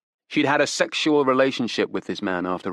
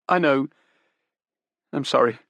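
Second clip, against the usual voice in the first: put-on higher, urgent young woman pleading; desperate young woman; 5 semitones higher, 4 dB louder than usual. Daughter defends her father to detectives, desperate. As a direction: grasp for excuses, pitched up.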